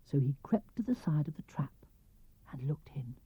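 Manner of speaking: hushed creeping whisper; whispered narration. A narrator's reading. Narrator following Piglet to the trap, creeping whisper. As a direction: whisper as if sneaking up.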